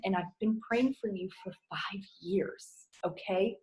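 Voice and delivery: soft whisper, heartfelt; whispered confession. Real, not acted. Coach to new team members, whispering tenderly. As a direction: let them in on a secret.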